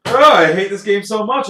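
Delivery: loud, fed-up venting; exasperated groan. Real, not acted. Venting about a bad game.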